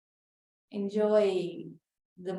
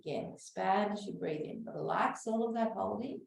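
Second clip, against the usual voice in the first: slow, soothing breath-work guidance; guided breathing; slower than usual. Acted. Teacher guiding a breathing class, calm and slow. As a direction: talk the group calmly through each breath.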